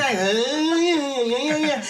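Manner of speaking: hammed-up empty reply; mock expected answer. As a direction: imitate a vague string of yeahs. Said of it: "somebody's expecting you to say".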